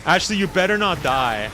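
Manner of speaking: warning scold. Real, not acted. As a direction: warn loudly, pitch up, half exasperated.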